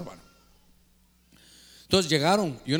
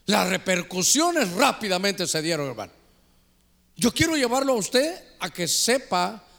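ordinary delivery

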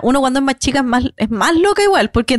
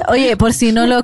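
normal talking voice

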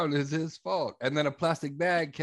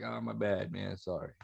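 soft apology; 6 semitones lower, 8 dB quieter than usual. Pretend.

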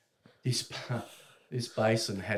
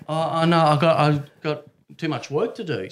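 panicked refusal; 5 semitones higher, 10 dB louder than usual. Retold or imitated.

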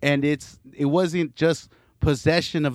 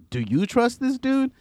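much higher doubtful question; incredulous question; 10 semitones higher than usual. Retold or imitated. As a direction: ask it in disbelief. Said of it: "and I was like".

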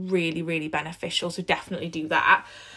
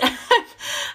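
short breathy laugh. Real, not acted. Laughs at her gross tummy.

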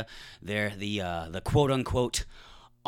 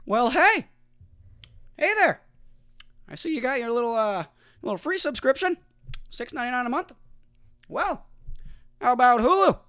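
high, loud sleazy salesman voice; Disney pitching a bundle, high and slick; Walt Disney voice; 10 semitones higher, 5 dB louder than usual. Retold or imitated. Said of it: "that voice is how Walt sounded".

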